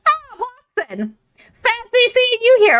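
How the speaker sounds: very high, slow mock-casual greeting; playfully chill greeting. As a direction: draw it out high, as if casually bumping into someone. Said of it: "something equally as just chill".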